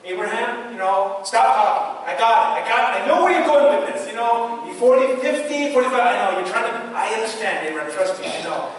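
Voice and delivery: an exasperated God cutting Abraham short; a hint of a hurried, dismissive Lord; God voiced, impatient. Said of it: "The Lord doesn't say".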